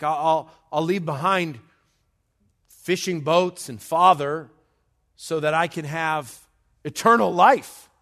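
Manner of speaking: loud, rising pulpit plea, peaking high at the end; impassioned preaching. Acted. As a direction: build to an exclaimed climax. Preaches as an eager follower.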